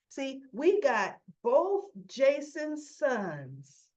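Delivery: ordinary talk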